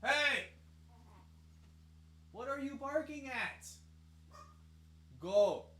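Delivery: ordinary talk, nothing marked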